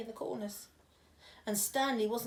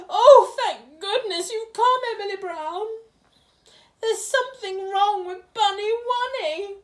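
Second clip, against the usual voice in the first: high, loud posh queen; 10 semitones higher, 14 dB louder than usual. Acted. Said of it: "That's a very posh voice you're doing there".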